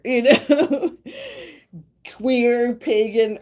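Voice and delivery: high, loud, giggling list; bubbly proud giggle; laughing proudly. Real, not acted. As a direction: declare it with a laugh.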